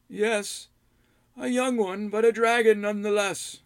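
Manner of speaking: put-on high old hermit voice; knowing old man, matter-of-fact. Rydoth answers.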